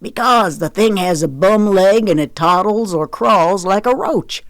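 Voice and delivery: lightly voiced, emphatic Southern father; Daddy, defensive. Daddy explains the chicken's name to Zoe, emphatic.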